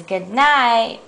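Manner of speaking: lightly voiced husband's goodnight; zookeeper husband. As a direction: answer plainly in a middle pitch. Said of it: "Mister Zookeeper says".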